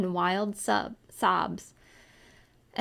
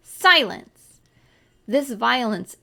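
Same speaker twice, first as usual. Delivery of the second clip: sharp shout; 3 semitones higher, 7 dB louder, slower than usual. A narrator's reading. Aunt cuts child off.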